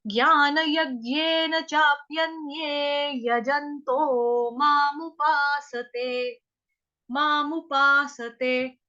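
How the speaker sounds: ज्ञान यज्ञेन चाप्यन्ये chanted slowly syllable by syllable; slow recitation. Shown as a demonstration. Teacher recites shloka line slowly for students to copy. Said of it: "दीर्घ मात्रा है".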